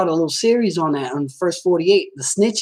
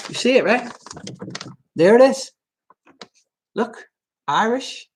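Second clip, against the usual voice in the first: ordinary delivery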